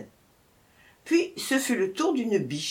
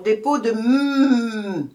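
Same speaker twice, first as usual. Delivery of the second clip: slow, faltering, trailing off; hesitant hare; 3 semitones higher, 6 dB louder, much slower than usual. A narrator's reading. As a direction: stall and break off in fear. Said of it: "Ce sont, dit le lièvre".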